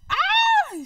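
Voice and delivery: a sudden high, loud squeal of delight; excited squeal. Real, not acted. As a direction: shriek with glee at the news. Reacting to Erica coming out.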